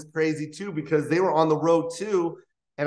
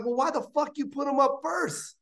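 high, quick, exasperated complaint; annoyed host; 11 semitones higher than usual. Retold or imitated. Rudy Rush complains to Bob about the running order, high and exasperated. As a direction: grumble at Bob, pitched well up.

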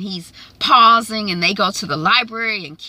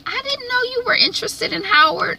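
pitched way up, sweet mother; high mom voice. Acted. Becca to teen son, a high sweet mother's voice. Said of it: "she's, um, like".

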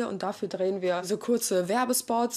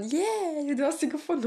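mock joyful; 6 semitones higher than usual. Shown as a demonstration. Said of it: "sobald wir die gefunden haben".

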